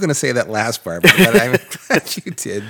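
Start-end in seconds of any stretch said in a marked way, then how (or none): 1.0-2.6 loud laugh through the words. Real, not acted. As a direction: laugh it out, slow and high. Laughs at crude remark.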